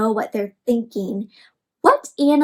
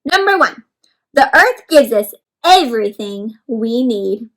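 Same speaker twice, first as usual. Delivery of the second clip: story reading; 5 dB louder than usual. Acted. Reading the worm's diary aloud.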